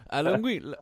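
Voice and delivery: high mock Italian voice; Italian accent. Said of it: "what other stereotypes can we do".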